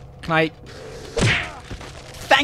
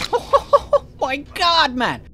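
laughing disbelief; 8 semitones higher, much slower than usual. Real, not acted.

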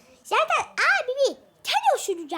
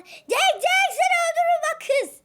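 high, loud call across the room; shouting out; 7 semitones higher, 3 dB louder than usual. Real, not acted. Sibling yelling at sister during a fight. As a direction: yell at her to get her attention. Kids squabbling, calling out.

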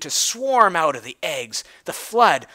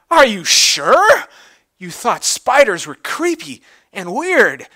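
surprised; 5 semitones higher, 8 dB louder than usual. Acted. Big Mac questions Twilight's plan, loud and astonished. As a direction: draw it out in shocked disbelief.

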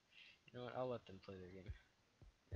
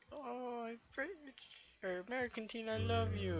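mocking voice; 13 semitones higher, 4 dB louder than usual. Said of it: "he was like".

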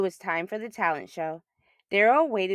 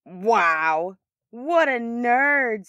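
slow, sneering schoolboy taunt, pitched up; mocking bully; 4 semitones higher, 4 dB louder, much slower than usual. Charlie sneering at Daryl's poem, slow mocking taunt. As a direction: drag out the insult like a smug bully.

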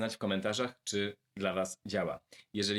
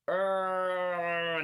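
long, high-pitched hesitation noise; drawn-out "eee" of a stalled speaker; stuck on a syllable; 8 semitones higher than usual. Shown as a demonstration. Speaker demonstrates hitting a hard syllable, stuck hesitation. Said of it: "następuje problem i nie jesteśmy w stanie iść dalej".

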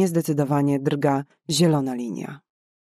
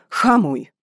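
loud, higher yelled order to the driver; urgent shout; 5 semitones higher, 5 dB louder than usual. Acted. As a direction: bark the command to stop now. Ratowniczka krzyczy do kierowcy.

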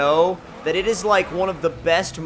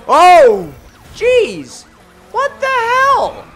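very high, loud, stretched exclamation; startled outcry; 9 dB louder, much slower than usual. Real, not acted. Driver caught off guard mid-race, high startled cry. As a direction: cry out in disbelief.

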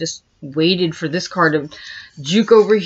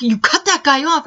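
higher, put-on voice of an accusing brother; 8 semitones higher than usual. Brother accusing her of cutting someone off, imitated. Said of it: "he's like".

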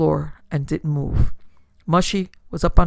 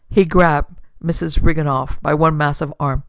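ordinary delivery, nothing marked

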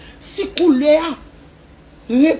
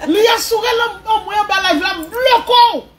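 crying shout; 7 semitones higher, 4 dB louder than usual. Real, not acted. Emotional climax of the story.

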